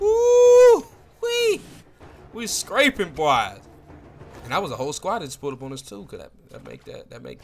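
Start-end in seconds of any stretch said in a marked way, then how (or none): 0.0-1.6 very high, drawn-out whoop; excited whoop. Real, not acted. Gamer whooping in the middle of a fight, excited. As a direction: let out a piercing "woo-wee".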